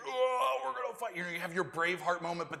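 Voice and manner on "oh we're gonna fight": higher mock-heroic soldier bluster; mocking battle cry. David mocks the soldiers' empty boasting, higher mock bravado. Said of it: "You can imagine David going".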